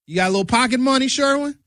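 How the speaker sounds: lightly voiced mom, quick and high; mother's voice. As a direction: bustle a question at your son like a mom. Mother offering pocket money.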